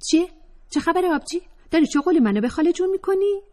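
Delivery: put-on high, fast, cheeky young woman; Atefeh's voice. Atefeh teasing the narrator, high and cheeky. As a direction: tease her sister-friend in a high voice.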